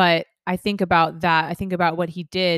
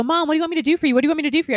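a hint of a keen little boy, a bit higher; lightly voiced eager child; 7 semitones higher, much faster than usual. Son asking his mom for a task, lightly voiced eager child. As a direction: play the son asking to please. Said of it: "if my son were immediately to go".

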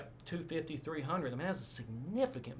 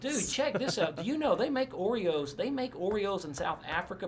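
louder, higher, eager outburst; real excitement; 6 semitones higher, 5 dB louder than usual. Real, not acted. Missionary telling friend about Oreos abroad, excited. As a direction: jump in with eager enthusiasm, pitched up. Listener mentions Oreos, he jumps in.

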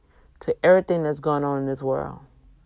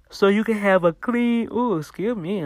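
yawning; 4 semitones higher than usual. Real, not acted. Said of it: "don't know why I'm still yawning".

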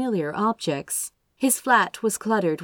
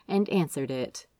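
ordinary delivery, nothing marked

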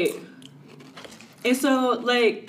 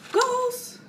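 much higher, soft mock fan; high mock gush; 13 semitones higher, 4 dB quieter than usual. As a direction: coo it like a fan. Imitating admirers.

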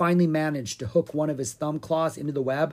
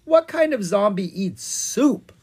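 puzzled witch; 8 semitones higher, 6 dB louder than usual. A narrator's reading.

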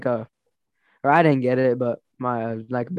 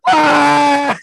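loud, high imitated scream; mock agonized "Ah!", pitched way up; violent scream; 14 semitones higher, 11 dB louder than usual. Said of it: "like, violently screaming while he's".

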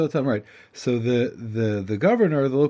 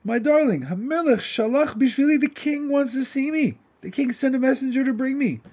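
excited husband; 10 semitones higher than usual. Acted. Simpleton tells his wife the king wants him, high and excited. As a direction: rush in bursting with news.

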